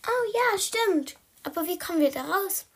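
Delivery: high little kitten voice; squeaky, high-pitched cat character; squeaky peep. Acted. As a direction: pipe up high and lively. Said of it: "piepste Blackie".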